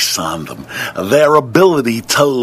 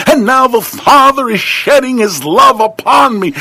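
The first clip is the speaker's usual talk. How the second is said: climbing, higher and louder sermon cadence; preaching at full force; 8 semitones higher, 3 dB louder than usual. Acted. Preacher testifies to his congregation with rising fervour. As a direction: preach it up to the rafters.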